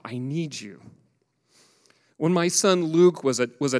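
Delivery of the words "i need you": softer, lower, pleading to God; a quiet mock cry of need; a soft plea. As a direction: breathe it out as a plea. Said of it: "He's crying out".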